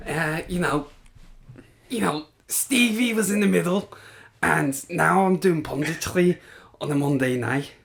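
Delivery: high-pitched Scouse pundit impression; hammed-up Scouse footballer turned pundit; Jamie. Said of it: "No, I'm just doing Jamie".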